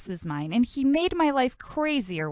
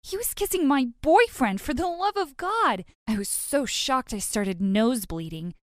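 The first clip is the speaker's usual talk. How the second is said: plain reading voice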